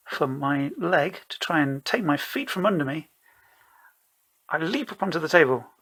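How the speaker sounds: usual speaking voice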